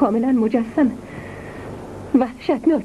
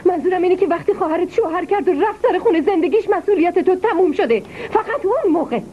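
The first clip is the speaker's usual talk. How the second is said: rising reproach; 6 semitones higher than usual. Acted. Mother reproaches son, rising.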